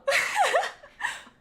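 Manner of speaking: high, gleeful cackle; high giggle. Acted. The reader breaks into a high giggle at the villain's return. Villain laugh after greeting.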